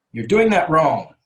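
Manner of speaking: loud, slow, blunt reproach; very direct. Shown as a demonstration. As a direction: tell them flatly they're wrong. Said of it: "this one's very direct".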